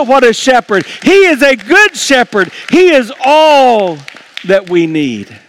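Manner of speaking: rising sermon climax, calling out to the church; preaching at full force. Acted. Pastor exhorting the congregation to celebrate, at full force.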